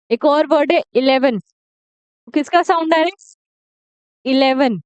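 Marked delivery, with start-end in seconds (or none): none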